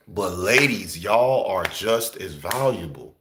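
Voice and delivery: ordinary delivery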